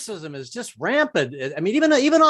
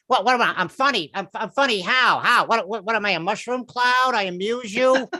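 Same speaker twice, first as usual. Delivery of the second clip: high, touchy mobster voice; Joe Pesci; 5 semitones higher than usual. Acted. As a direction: play an affronted wiseguy demanding answers. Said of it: "sound like a female Joe Pesci".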